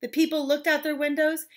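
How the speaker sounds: ordinary delivery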